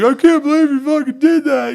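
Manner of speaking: high, loud, whiny mock sob; mocking a crying teammate. Drunk teammate crying after getting hit, high whiny mock wail. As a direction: ham up a blubbering drunk. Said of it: "And he was fucking crying".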